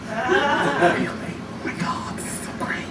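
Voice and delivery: soft, whispered giddy delight; breathy swoon. Retold or imitated. Swooning over his compliment in a hushed whisper. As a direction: sigh it out, smitten.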